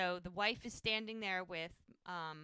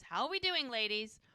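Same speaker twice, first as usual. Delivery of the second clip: high, louder breezy greeting; owns the place; 3 semitones higher, 4 dB louder than usual. Retold or imitated. Hope struts in greeting the ladies, like she owns the place. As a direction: sweep in with mock self-importance. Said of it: "strutting in like she owns the place".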